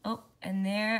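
plain reading voice

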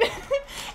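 a quick airless laugh at herself; breathy giggle. Real, not acted. Laughing at herself after admitting she cried. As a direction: let a small embarrassed giggle slip out. Laughing at her own crying.

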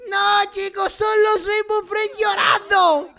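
high, drawn-out cry of dismay; dismayed exclamation. Real, not acted. Gamer discovering the Rainbow Friends crying, dismayed exclamation. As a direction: exclaim it high, dragging out the ending.

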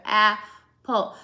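apple, very slow, jaw dropping twice; slow syllable demo. Shown as a demonstration. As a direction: say apple slowly for the class. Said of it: "hard to do when everybody's watching".

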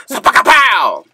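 high, punchy spoken smack sound; imitated smack. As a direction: voice the blow as a cartoon "pow". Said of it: "I'm just gonna haul off and".

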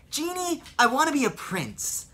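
youthful hero pleading with the genie; Aladdin voice. Retold or imitated. Aladdin asking the genie to make him a prince. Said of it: "one of the ones who's actually very close to my own voice".

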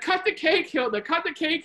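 high, imitated crowd chant; eager urging. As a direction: egg her on with a repeated call. Guests urge Hilda to cut cake.

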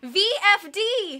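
high, loud, drawn-out letters; desperate stretched shout; scream. A narrator's reading. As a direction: scream each letter slowly. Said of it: "he managed to scream again".

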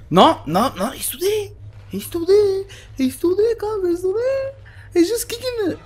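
high, thin anxious squeak; high frightened whining; panicked whimper. Real, not acted. Panicking, enemy still there.

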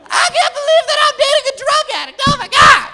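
put-on high hysterical shrieking girlfriend; mock girlfriend screaming in a loud high voice. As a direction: shriek at him like a frantic girlfriend. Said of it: "she's screaming".

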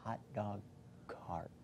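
slow, distinct whisper. Shown as a demonstration. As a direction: whisper each word clearly for a lip-reader. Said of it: "who's gonna whisper to me".

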